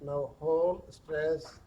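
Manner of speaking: very slow, hushed body-scan voice with long pauses; guided meditation. Acted. Yoga teacher guiding stress down the arms, slow guided meditation.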